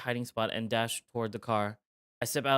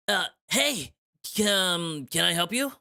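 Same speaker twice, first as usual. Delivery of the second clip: nervous hero voice; 6 semitones higher, 5 dB louder, slower than usual. Acted. Player voicing Tom to eagle.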